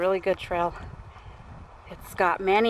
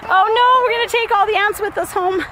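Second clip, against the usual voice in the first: alarmed; 11 semitones higher, 5 dB louder than usual. Real, not acted. Ants on them.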